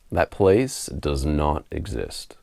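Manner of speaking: normal talking voice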